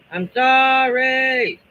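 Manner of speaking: loud, high, slow sorry; drawn-out apology. Real, not acted. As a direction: stretch out a big apology. Apologizing to viewers after glitch.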